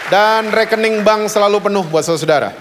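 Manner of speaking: loud, fast, fervent blessing. Real, not acted. Pastor calling blessings over the congregation, loud and fast. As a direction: bless the congregation at full force. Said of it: "Kenceng banget kalau gitu-gituan ya".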